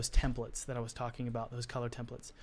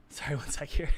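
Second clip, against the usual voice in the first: quick whispered aside. Real, not acted. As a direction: whisper it under your breath. Aside while reading chat.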